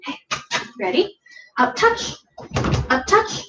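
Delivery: high rising up touch cue; talking to a dog. Real, not acted.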